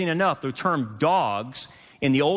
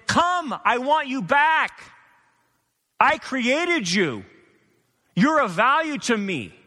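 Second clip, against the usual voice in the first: God pleading; 8 semitones higher than usual. Acted. God calling his lost children back home, high pleading voice. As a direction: call them back like a longing father. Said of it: "But God is saying".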